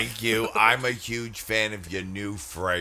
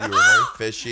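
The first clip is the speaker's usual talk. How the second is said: high excited squeal, much higher; 4 dB louder, much faster than usual. Acted. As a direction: squeal it out with a teasing laugh. Teasing squeal.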